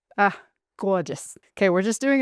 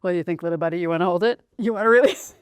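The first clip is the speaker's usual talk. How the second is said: usual speaking voice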